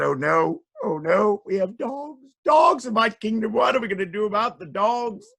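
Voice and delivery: mock alarm climbing high and loud; panicking king. A narrator's reading. King discovering dogs in his kingdom, rising panic. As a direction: build from dismay to a high fretful cry.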